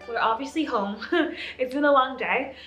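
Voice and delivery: ordinary delivery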